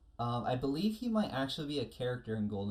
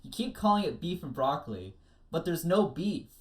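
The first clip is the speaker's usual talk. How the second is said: louder and louder complaint, building up to a shout; complaining character; 6 semitones higher, 7 dB louder than usual. Acted. Podcaster quoting a cartoon character complaining, building to an exasperated shout. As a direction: protest it, rising to a yell. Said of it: "a character complains".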